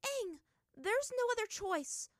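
high, loud, hurting snap; said sharply. A narrator's reading. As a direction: cut him off, hiding the pain. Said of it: "Azula said sharply to him".

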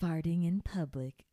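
breathy mock-sexy whisper. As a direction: whisper it like a seduction. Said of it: "Are you trying to be, like, sexy".

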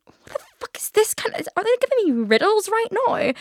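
mock outrage at being given riddles; baffled disbelief. Baffled by airport staff directions.

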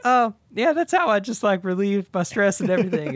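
breezy show-off. Retold or imitated. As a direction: brush off the praise, pleased with himself. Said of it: "It's like".

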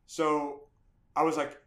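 normal talking voice

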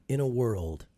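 slow-burn movie trailer intro; hushed, dramatic announcer delivery. Shown as a demonstration. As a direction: intone it like a film trailer narrator. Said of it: "just very dramatic, just go like this".

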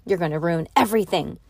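plain reading voice

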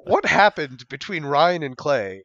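loud, high disbelief; incredulous. Retold or imitated. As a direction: demand what happened, amazed. Retold incredulous question.

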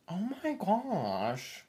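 slow, drawn-out Lisa impression. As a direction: stretch it out like Lisa. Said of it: "like, high, but also deep".

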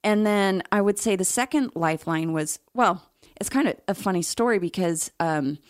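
normal talking voice

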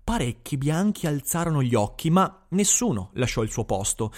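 ordinary delivery

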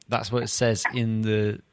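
usual speaking voice

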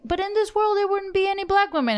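high-pitched mimicry of an objector; imitated objection. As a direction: put on a high protesting voice. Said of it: "when you're like".

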